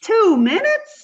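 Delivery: high, slow, stunned echo; a dumb question drawn out high and slow; shocked disbelief. Acted. Shocked at a two-minute stay.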